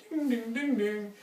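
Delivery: high sing-song ding ding of a shop door bell; imitated door chime. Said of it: "I can hear it go".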